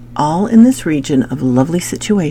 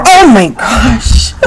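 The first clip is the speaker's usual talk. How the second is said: startled; 7 semitones higher, 11 dB louder, slower than usual. Real, not acted. Startled by barking dog on stream.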